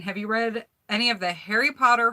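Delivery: normal talking voice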